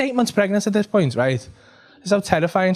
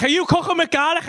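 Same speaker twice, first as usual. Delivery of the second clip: mock wife question; 9 semitones higher than usual. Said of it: "she's like".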